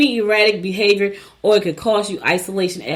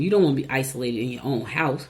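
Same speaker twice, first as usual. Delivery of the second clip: normal talking voice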